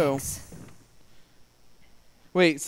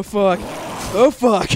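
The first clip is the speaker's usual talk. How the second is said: loud, higher panicked curse; real panic; 5 semitones higher, 8 dB louder, slower than usual. Real, not acted. Player fleeing the monster in a game, loud panicked curse. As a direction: blurt it out as the monster closes in.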